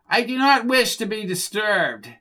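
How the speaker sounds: high, haughty whine; high-pitched, snooty complaint, not truly loud; whining elite voice. A narrator's reading. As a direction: whine it like an offended snob. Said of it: "in a loud, whining, elite voice".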